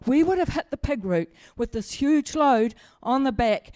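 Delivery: ordinary talk, nothing marked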